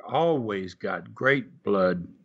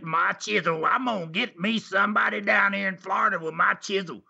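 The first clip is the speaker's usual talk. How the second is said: put-on Tennessee accent; 7 semitones higher than usual. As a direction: do the old wrestler's Tennessee twang, bragging. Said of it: "He had that old in a Tennessee accent".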